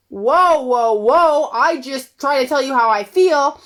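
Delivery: high, loud mock whoa of a wife taken aback; alarmed protest. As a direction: push back loudly, hurt. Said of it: "And you're like".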